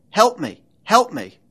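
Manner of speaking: loud, high mechanical plea for help; pleading computer voice. A narrator's reading. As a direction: cry out like a desperate machine. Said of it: "the computer's mechanical voice pleaded".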